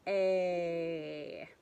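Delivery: A drawn out as a long ay. Shown as a demonstration. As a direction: say A as a long ay sound. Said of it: "long A sound right now".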